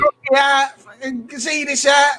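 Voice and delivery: high Cockney chant, shouted; mock Cockney fan shout. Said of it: "He's a proper Cockney lad".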